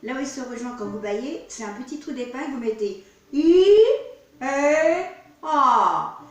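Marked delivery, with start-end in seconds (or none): 2.5-6.2 I, E, A sung out loud, each one more open; vowel demo. Shown as a demonstration. Singing teacher demonstrating I, E, A, loud and open. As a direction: belt "I", "E", "A" with the mouth opening wider each time. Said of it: "Le E est plus ouvert que le I".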